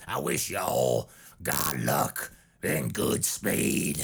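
deep, slow Southern blessing in Dixie Dave's voice. Shown as a demonstration. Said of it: "let's do it in the Dixie Dave voice".

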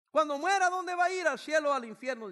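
high, loud urgent questions; urgent preaching. Real, not acted. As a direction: press them to decide. Altar call questions.

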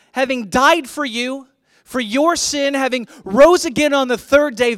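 loud, high, emphatic preacher delivery; forceful preaching. Real, not acted. Preacher urging the church to stand firm, forceful preaching. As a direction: hammer each phrase home to the congregation.

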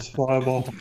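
mechanisch und steif; kaputte Maske. Retold or imitated. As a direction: wie eine defekte Roboterstimme. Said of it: "Das ist mir gut". (Stimme einer kaputten Maske)

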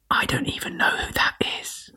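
confessional whisper; whispered aside. Acted. Whispered aside after the bio.

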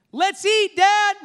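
high, bright-eyed eager call; eager happy tone. Shown as a demonstration. Preacher showing kids' eager tone at dinner, high and excited. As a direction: say it like dinner's the best news. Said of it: "let me just tell you how important your tone is".

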